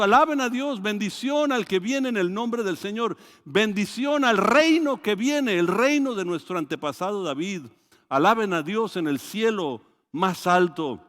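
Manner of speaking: loud, high joyful acclamation; crowd praising. Retold or imitated. Preacher voicing the crowd greeting Jesus, loud and high. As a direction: proclaim it like a whole crowd shouting praise. Y sigue diciendo.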